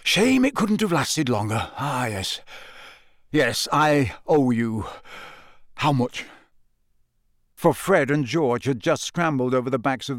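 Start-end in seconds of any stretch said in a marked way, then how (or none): 0.0-2.4 hoarse. A narrator's reading. Bagman reflecting on the match, hoarse. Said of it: "he said hoarsely". 3.3-6.2 slow, hoarse, reluctant admission. A narrator's reading. Bagman facing the twins' bet, hoarse. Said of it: "he said hoarsely".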